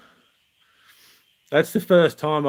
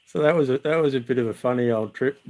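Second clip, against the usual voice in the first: normal talking voice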